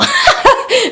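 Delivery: loud high giggle; high burst of laughter. Real, not acted. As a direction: break into a squealing laugh. Laughs about isolating an hour.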